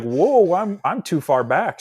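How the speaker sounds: high, loud mock alarm; reliving a comic moment of surprise; startled reaction. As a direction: blurt it out as if caught off guard. Acts out surprise in Mini Cooper.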